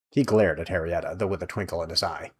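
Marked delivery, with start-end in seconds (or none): none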